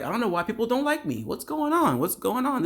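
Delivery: high-pitched imitation of bewildered complaining; mock whining complaint. Woman wondering why people dislike her, mock whining.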